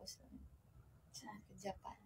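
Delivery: breathy hushed aside; whisper. Real, not acted. Telling viewers she cried in Japan, whispered. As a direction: drop to a secret whisper.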